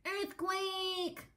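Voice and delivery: high, loud shout of alarm; alarmed cry. A narrator's reading. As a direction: cry out as the ground shakes. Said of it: "cried Fluffy the Explorer".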